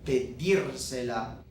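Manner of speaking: "pedírsela" slowly, as pe-DIR-se-la; slow model of "pedírsela", stressed on the verb's last syllable; stress kept on DIR. Shown as a demonstration. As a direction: hold the stress on "dír" in "pedírsela". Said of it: "have that verb sound the".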